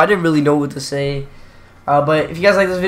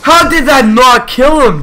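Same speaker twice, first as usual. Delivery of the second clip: high, loud furious protest; angry outburst; 9 semitones higher, 9 dB louder than usual. Real, not acted. Gamer raging at his game over a missed kill, loud and high. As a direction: yell it at the screen in disbelief.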